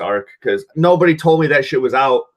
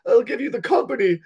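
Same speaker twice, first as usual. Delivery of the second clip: mock crying plea; 12 semitones higher than usual. Villain crying at the board meeting, whiny begging. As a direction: beg like a sobbing coward, hammed-up. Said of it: "This dude was crying".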